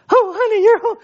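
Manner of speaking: very high, loud, gushing mom greeting. Retold or imitated. Mother greeting her son home from deployment, high and gushing. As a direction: squeal it like a mother at the door.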